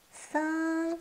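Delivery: « San » doux et haut; « San » léger, sans appuyer; san tout doucement. Shown as a demonstration. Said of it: "prononcez tout doucement". (san prononcé en douceur)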